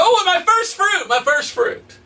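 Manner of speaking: high thrilled cry, easing down; excited. Retold or imitated. Gardener greeting his first fruit, high excited coo. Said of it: "I get all excited".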